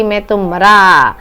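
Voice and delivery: lightly hammed-up groan of pain; teacher playing the master in pain; mock dying groan. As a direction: moan like you're done for, playfully. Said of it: "आह means हाय रे".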